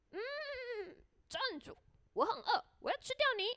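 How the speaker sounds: high storybook horse voice; whinny a hum, then squeak out the threat; hungry horse. A narrator's reading. Horse halting the gingerbread man, high hungry horse voice. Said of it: "马说".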